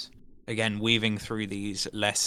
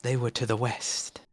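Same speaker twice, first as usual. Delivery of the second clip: eerie whisper of a talking head. Acted. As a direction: whisper it as a severed head's secret. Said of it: "the head whispers to you".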